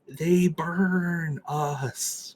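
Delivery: very slow, stretched words; slow, elongated mock dread; drawn-out warning. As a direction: drag each word out ominously. Ominous answer to own question.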